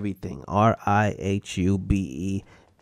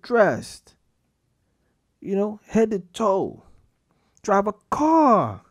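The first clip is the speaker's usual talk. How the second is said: exasperated rant; 13 semitones higher, 4 dB louder than usual. Acted. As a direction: scold the listeners like they should know better, stretching every brush your teeth.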